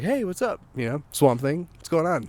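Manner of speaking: high, easygoing hello in the dog's place; casual dog greeting. Retold or imitated. Dog greets its mud-covered owner, casual high greeting.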